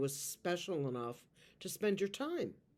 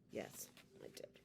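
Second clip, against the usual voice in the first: whisper; 18 dB quieter than usual. Acted. Whispered admission.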